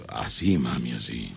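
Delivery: host demonstrating a low coquettish line to the caller; flirty northern come-on. Shown as a demonstration. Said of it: "de una manera coqueta, del norte".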